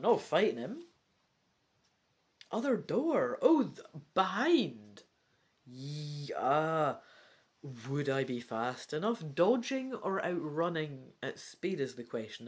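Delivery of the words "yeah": ordinary delivery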